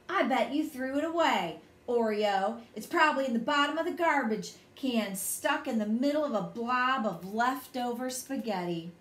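ordinary delivery

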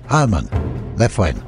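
ordinary delivery

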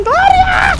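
high, loud call of a name. Real, not acted. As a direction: call her from afar. Calling a name excitedly.

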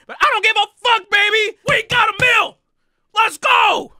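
high, loud, fast celebratory yell; excited yelling. Real, not acted. Streamer yelling to chat after the champagne, excited shouting. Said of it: "sorry if I'm yelling and it's really loud".

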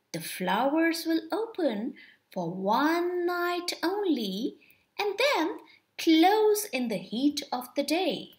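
plain reading voice

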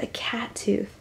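shocked little whisper; scandalized whisper. Real, not acted. Shocked aside after a gasp.

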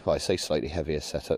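normal talking voice

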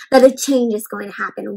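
plain reading voice

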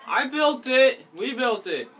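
high, loud objection; indignant protest. Real, not acted. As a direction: protest loudly at being contradicted. Disputing who built the game.

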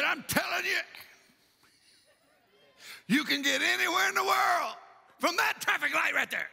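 high-pitched, emphatic preacher impression; preacher father with attitude. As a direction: imitate dad declaring it from the pulpit. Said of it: "you could tell that attitude".

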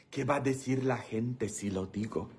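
low, very soft musing; hushed aside. Pretend. Comedian wondering whether to tell the joke, hushed aside. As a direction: drop it down to a quiet aside.

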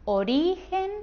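"origen" with a high, stretched RI; stress on middle syllable. Shown as a demonstration. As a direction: lean on the RI in "origen". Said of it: "tiene la sílaba tónica en la penúltima". ("origen" con acento en la sílaba RI)

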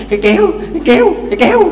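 out-of-breath wife still insisting to her husband, stubbornly. Acted. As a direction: chant it back stubbornly with a laugh.

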